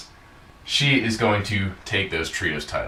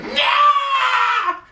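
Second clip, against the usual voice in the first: mock outraged shriek; very high, strained comic screech; Cobra Moon; 4 dB louder than usual. Acting out the villain stunned by the wish, shrieking. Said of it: "Cobra Moon's like".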